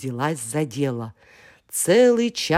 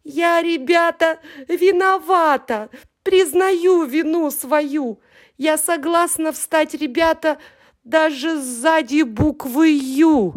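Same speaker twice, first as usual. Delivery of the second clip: very high, loud tearful wail; hammed-up crying child; bawling apology; 12 semitones higher, 4 dB louder than usual. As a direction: sob out the confession. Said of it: "а потом как заревёт".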